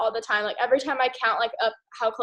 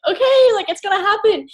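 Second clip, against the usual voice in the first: much higher, louder burst of belief; sudden fired-up yes; excited agreement; 8 semitones higher, 7 dB louder than usual. Retold or imitated. She catches Shay's belief, retold.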